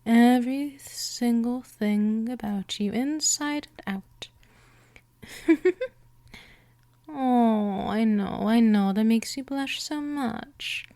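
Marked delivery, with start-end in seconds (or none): 5.2-5.9 high airy teasing giggle; a little mocking laugh at the blushing listener; playful vampire giggle.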